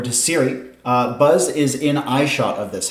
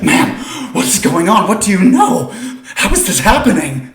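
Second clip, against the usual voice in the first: alarmed, higher and louder; terrified bystanders; 6 semitones higher, 5 dB louder than usual. Acted. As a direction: demand answers in a breathy panic. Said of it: "People are terrified".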